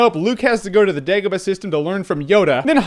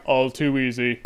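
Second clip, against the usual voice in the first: Vader voice; 8 semitones lower, 6 dB quieter than usual. Retold or imitated. Vader quote, low quiet.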